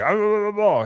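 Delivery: imitating an angry sick man's grumbling; mock angry grumbling. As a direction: mimic his mad grumbling. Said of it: "Boy, he was pissed".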